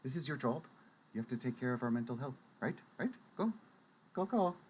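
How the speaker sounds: soft, fast coaxing push; gently pushing Phaedra forward, soft urging; gentle urging. Acted. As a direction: coax her on under your breath. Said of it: "gently push from behind, and I'm like".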